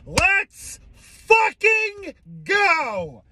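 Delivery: very high, slow, stretched celebration; high drawn-out victory cry; gleeful cheer. Real, not acted. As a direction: crow it out in triumph. Celebrating player being cut.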